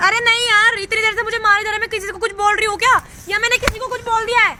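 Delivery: loud, fast hurt protest; hurt and angry, pushing him away; loud upset protest; upset complaint. Real, not acted. Upset girlfriend complains after slaps.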